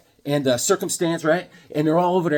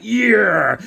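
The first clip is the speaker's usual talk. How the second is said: loud triumphant shout; powered-up shout; triumphant yell; 5 semitones higher, 6 dB louder than usual. Retold or imitated. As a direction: yell it as you rise up. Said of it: "he stands up".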